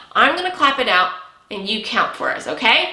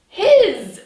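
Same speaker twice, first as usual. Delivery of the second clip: his said higher and louder to blend it; 8 semitones higher, 4 dB louder than usual. Shown as a demonstration.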